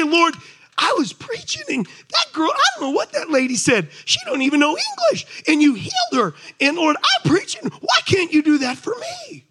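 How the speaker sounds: high, loud whiny complaint to God; whining complaint. Acted. Preacher mocking a jealous believer's prayer, high whine.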